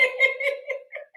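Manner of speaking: high shriek of laughter. Real, not acted. Laughing about YouTube chicks, high shriek.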